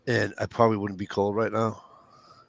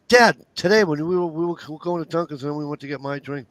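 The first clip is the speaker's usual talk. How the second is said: higher, lightly mimicked teen girl; pitched-up daughter, loud at first then easing; teenage daughter; 6 semitones higher, 4 dB louder than usual. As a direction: imitate a teenager calling to Dad, then running on. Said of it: "The sixteen-year-old's in the back seat".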